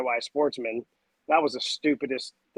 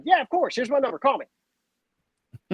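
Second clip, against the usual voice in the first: Ed's easy reply; 9 semitones higher, slower than usual. Retold or imitated. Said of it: "And he replied".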